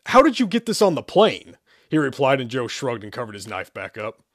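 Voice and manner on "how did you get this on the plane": higher, louder sudden question; startled demand. Acted. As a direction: snap out of the trance.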